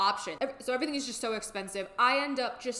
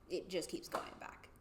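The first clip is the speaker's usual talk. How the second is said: defeated whisper; hopeless whispered aside; 2 semitones lower, 10 dB quieter than usual. Real, not acted. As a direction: breathe it out, drained. Despairing about repeated delays.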